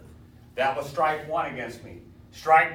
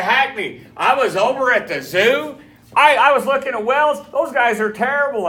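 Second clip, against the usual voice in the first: much louder, higher eager report; excited Burrell; 10 semitones higher, 14 dB louder than usual. Retold or imitated. Student tells the teacher about terrible zoo welds, loud and excited. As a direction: blurt it out like big news.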